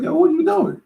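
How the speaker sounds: a sudden high startled protest. Retold or imitated. Cousin squeezes his face, he yelps in surprise. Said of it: "and I was like".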